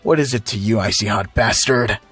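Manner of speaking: low, surly hothead voice; contained anger, pitched low; pissed off. A narrator's reading. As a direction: challenge him in a low growly register. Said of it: "getting pissed".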